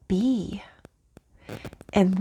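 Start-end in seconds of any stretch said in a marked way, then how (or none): 0.1-0.6 "be" whispered with no voice; whispered word. Real, not acted. Whispering "be" the spirits make her say. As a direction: breathe out "be" as a whisper. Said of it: "they keep making me say the word".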